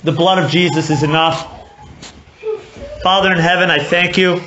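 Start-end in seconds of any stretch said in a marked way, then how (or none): 3.0-4.4 closing prayer. Acted. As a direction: pray it humbly, fading down at the end. Closing prayer after sermon.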